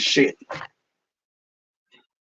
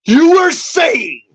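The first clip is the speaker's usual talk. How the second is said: challenging yell, much higher; angry shout; 4 semitones higher than usual. Real, not acted. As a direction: dare them to answer, shouting and close to tears. Taunting the person he's ranting at.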